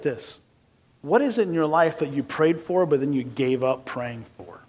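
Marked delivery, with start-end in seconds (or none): none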